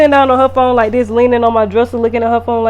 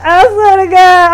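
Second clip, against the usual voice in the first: laughing to tears; 7 semitones higher, 7 dB louder than usual. Real, not acted. Laughing so hard it cries.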